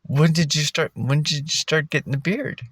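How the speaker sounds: a light impression of a puzzled woman; imitating the girl. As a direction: ask it like the confused barista. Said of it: "She goes".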